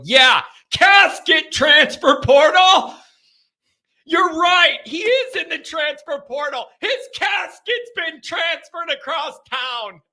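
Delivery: high-pitched incredulous shouting; loud, hammed-up indignation; comic outburst. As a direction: holler it in mock disbelief. Comic outburst at Rockne portal joke.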